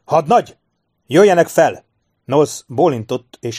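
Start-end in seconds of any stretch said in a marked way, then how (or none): 0.0-1.8 high, loud far-carrying call; hollered order. A narrator's reading. Officer calling his lieutenant up the stairs, hollered order. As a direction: holler as if through cupped hands. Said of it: "Sharp tölcsért formált a kezéből".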